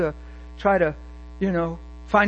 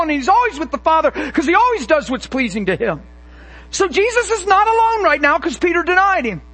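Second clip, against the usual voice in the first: higher, louder fiery preaching; full-force preaching; 5 semitones higher, 4 dB louder than usual. Acted. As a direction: drive it home to the congregation.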